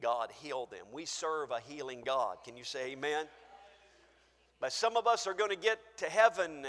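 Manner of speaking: ordinary talk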